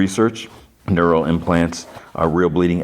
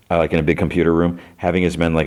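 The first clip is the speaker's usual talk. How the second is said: usual speaking voice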